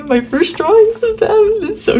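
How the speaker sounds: high giddy squeal; excited squeal. Real, not acted. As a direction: squeak it out like you can't contain yourself. Gushing over old fan drawings.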